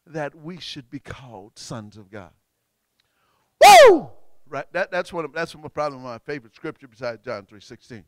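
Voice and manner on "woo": loud, high-pitched whoop; exultant whoop. Real, not acted. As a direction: whoop with joy over the verse. Whoop after reciting favourite scripture.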